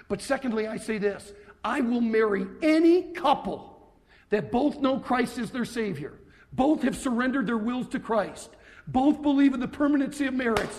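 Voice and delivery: loud, driving pulpit delivery; forceful preaching. Acted. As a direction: preach it hard to the hall. Preaching on marriage to congregation.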